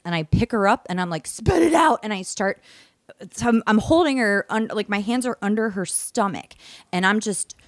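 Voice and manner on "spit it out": high-pitched urgent mock yell; imitating a frantic order to a dog; retold yell. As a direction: cry it out high, like a desperate command. Retelling yelling at choking dog.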